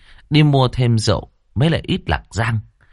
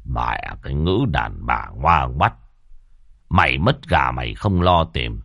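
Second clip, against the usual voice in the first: put-on weary old man grumbling; 5 semitones lower than usual. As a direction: shake your head and grumble under your breath. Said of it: "cụ khẽ lắc đầu thở dài".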